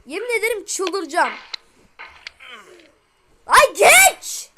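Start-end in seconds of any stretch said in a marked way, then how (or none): 3.5-4.5 high, loud yelp at the screen; exasperated yell. Real, not acted. Gamer yelling at his character to get past, high loud yell. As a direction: snap it out at the character.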